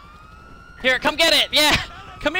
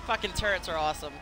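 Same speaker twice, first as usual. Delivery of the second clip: usual speaking voice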